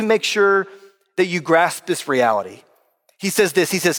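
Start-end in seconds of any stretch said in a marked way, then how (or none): none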